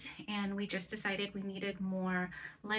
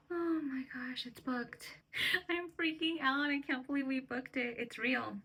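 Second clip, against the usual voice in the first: real gasp, then higher, giddy disbelief; freaking out; 5 semitones higher than usual. Real, not acted. Traveler just booked the flight, freaking out. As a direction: let the excitement spill out after a gasp.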